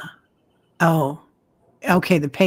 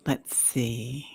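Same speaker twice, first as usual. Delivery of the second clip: whispered to self; 3 semitones lower, 9 dB quieter than usual. Real, not acted. Browsing questions quietly.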